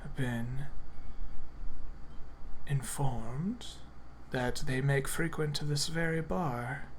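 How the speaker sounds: soft, conspiratorial murmur. Acted. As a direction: lean in and keep it secret. Patron keeps hushed voice.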